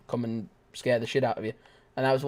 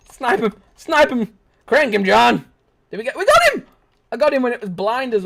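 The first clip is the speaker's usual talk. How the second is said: high, loud hype yells; excited shouting; 11 semitones higher, 8 dB louder than usual. Real, not acted. Gamer yelling at teammate John to snipe. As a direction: cheer your teammate on.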